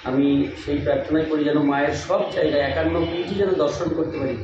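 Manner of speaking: soft, low murmured prayer to the goddess; hushed devotional plea; quiet prayer. Real, not acted. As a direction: pray softly, eyes closed before the deity. Prays softly before the goddess.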